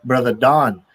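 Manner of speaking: ordinary delivery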